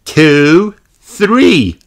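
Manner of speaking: put-on high little girl voice counting up; Peppa counting. A narrator's reading. Peppa counting for hide and seek, high and eager. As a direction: count like an excited young pig. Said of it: "said Peppa".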